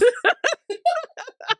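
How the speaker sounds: loud high laughter; high laughing burst. Real, not acted. Laughing at daughter's joke.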